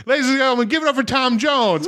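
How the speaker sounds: emcee announcement. Retold or imitated. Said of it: "And he'd go".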